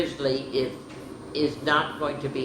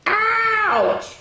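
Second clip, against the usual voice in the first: high mock ouch; 7 dB louder than usual. A narrator's reading. Reader voices a cry of pain.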